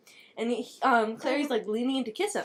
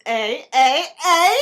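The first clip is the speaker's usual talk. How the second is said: nudging eh; 2 semitones higher, 9 dB louder, slower than usual. Acted. [name] nudging, joking.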